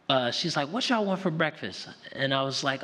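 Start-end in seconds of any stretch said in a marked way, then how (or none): 0.7-1.9 higher-pitched retold question; lightly voiced grandmother. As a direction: lightly imitate a grandmother asking the kids. Acts out grandmother asking children.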